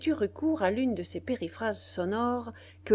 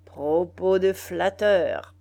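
slow, louder, scornful retort; contemptuous retort; 7 dB louder, slower than usual. A narrator's reading. Biassou dismissing the flatterer, slow and scornful. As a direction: spit out each word slowly. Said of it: "répliqua Biassou".

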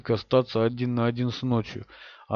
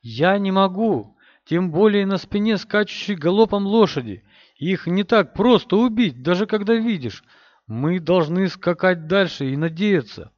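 high, loud call over hoofbeats; shouting over wind; 6 semitones higher, 4 dB louder than usual. A narrator's reading. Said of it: "громко перекрикивая ветер и топот копыт".

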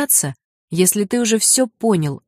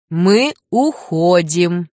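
Мы уходим slow, louder, each word pressed; stricter; 4 dB louder, much slower than usual. Acted. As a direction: say Мы уходим firmly and slowly, stern. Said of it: "Лю повторил строже".

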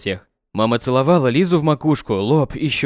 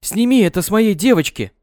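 визг; 7 semitones higher, 3 dB louder than usual. Acted. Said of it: "а визжала".

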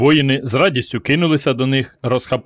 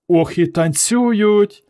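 high, slow delighted exclamation; 9 semitones higher, much slower than usual. A narrator's reading. Girl admires the cave people's dance, delighted exclamation. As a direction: marvel at the dancing. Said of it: "захоплено сказала Варка".

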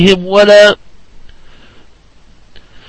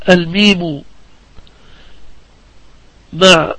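normal talking voice

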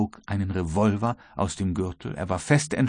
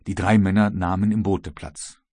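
ordinary delivery, nothing marked

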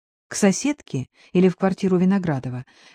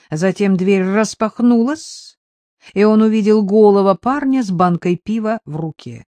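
ordinary delivery, nothing marked

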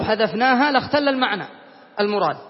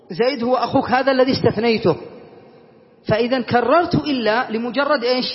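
normal talking voice

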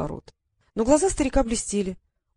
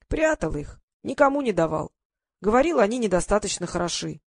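plain reading voice

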